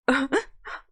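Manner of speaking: high, loud blubbering sob; hammed-up sob. Hagrid breaks down over baby Harry, loud high sob. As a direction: burst into a giant's sob. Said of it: "diye hıçkırdı".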